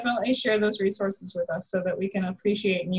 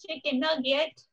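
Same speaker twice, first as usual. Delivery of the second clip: high cooing pet voice; 5 semitones higher, slower than usual. Real, not acted. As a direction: coo at the puppy. Cooing to puppy.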